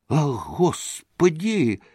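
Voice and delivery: slow, high horrified woman's cry; frightened maid crying out after him; horrified gasp. A narrator's reading. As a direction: throw up your hands in horror. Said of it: "всплеснула руками Феня".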